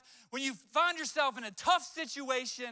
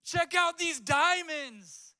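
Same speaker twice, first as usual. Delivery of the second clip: excited friend; 3 semitones higher, 6 dB louder, much slower than usual. Retold or imitated. Friend shows off his market Rolex, loud excited drawl. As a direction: show off the fake Rolex in awe. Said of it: "He was so excited".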